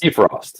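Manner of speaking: "Bifrost" pronounced BEE-frost. Shown as a demonstration. Co-host correcting the other's pronunciation, Bifrost said BEE-frost. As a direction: say Bifrost with an "ee" in the first syllable. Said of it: "It's pronounced Bifrost".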